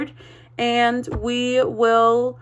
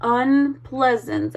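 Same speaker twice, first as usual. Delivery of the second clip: ordinary talk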